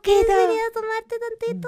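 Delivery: high cooing pet voice; baby talk to a dog. Acted. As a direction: coo at a puppy, high-pitched.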